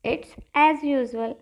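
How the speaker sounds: very slow and even; slow drill. Shown as a demonstration. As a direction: lay out each word for the class. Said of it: "तो कहिए".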